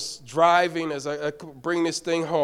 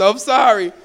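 ordinary talk, nothing marked

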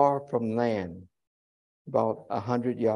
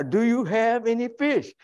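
high, sing-song question; mock woman asking; 10 semitones higher than usual. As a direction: pitch it up, teasing. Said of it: "some woman out there".

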